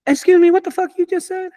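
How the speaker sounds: high-pitched, loud incredulous comeback; outraged disbelief. Retold or imitated. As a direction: shoot back in shocked disbelief. Retells own outraged reply to inspector.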